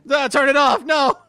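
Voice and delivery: loud, high alarmed yell; panicked shout. Real, not acted. Controller turned on by accident.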